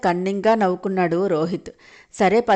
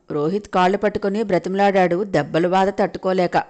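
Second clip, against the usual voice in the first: usual speaking voice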